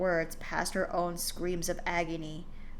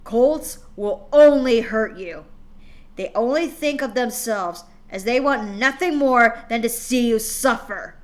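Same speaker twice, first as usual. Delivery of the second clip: furious father; 2 semitones higher, 10 dB louder than usual. Acted. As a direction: bear down on her with every word, loud. Father shouting at daughter.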